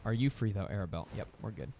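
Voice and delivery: usual speaking voice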